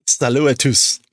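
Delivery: mock aggressive French hello; aggressive French greeting. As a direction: bark out "Salut à tous" like a pushy Frenchman. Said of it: "awfully aggressive sounding".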